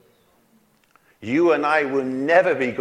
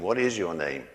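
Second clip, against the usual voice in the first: God asking, lower and softer; quiet voice of God; 8 semitones lower, 5 dB quieter than usual. Retold or imitated. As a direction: let God's question drop low and soft. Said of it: "And God said to him".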